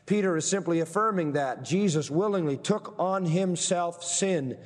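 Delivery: ordinary talk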